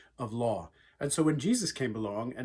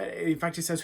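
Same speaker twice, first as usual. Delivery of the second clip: ordinary talk, nothing marked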